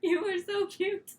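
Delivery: high cooing pet voice. Real, not acted. Cooing at the dog on camera, baby talk. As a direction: coo at the puppy.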